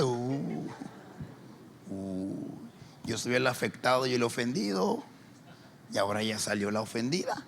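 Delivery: ordinary talk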